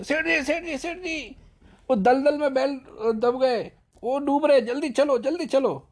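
very high, loud frantic calls; panicked shout. Retold or imitated. Servant running to the master crying that the oxen are sinking, frantic yell. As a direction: scream for help in mock panic. Servant runs in raising alarm.

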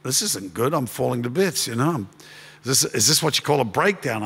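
low, soft, fragile retelling of near tears; close to weeping. Retold or imitated. Pastor recalling his breakdown to a friend, softly near tears. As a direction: let the voice crack a little as if holding back tears. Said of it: "but I started weeping".

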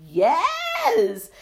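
high, drawn-out whoop of yes; jubilant, long high cry; exultant cheer. Real, not acted. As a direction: let it soar up in celebration. Exults over the coming year.

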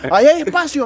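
high, insistent imitation of a conductor; pushy conductor voice, mocking; mock bus conductor. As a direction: mimic him insisting, pitched well up. Mimicking conductor insisting there is space.

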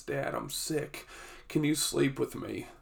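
put-on high, soft, whispered little sick boy; imitating a sick child. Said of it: "and he's crying".

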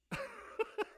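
short high-pitched laugh. Real, not acted. Preacher laughing at his own joke.

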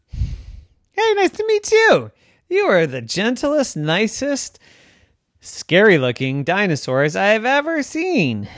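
very high tiny mouse voice; squeaky mouse voice. Acted. Mouse speaks.

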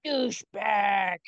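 slow, put-on possessed-kid insult; kid's demon impression. Podcaster mocking the boy's name-calling, slow fake-demon voice. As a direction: draw it out like a child faking possession. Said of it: "a kid who's doing a best impression of these things".

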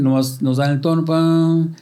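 ordinary talk

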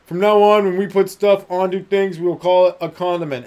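mock-solemn declaration of a new word; grand proclamation. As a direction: proclaim it like a royal decree. Proclaiming the name condiment.

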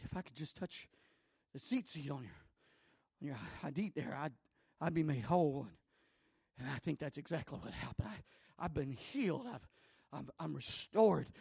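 soft, low confessing woman; a soft, low woman's awed testimony; quiet confession. Acted.

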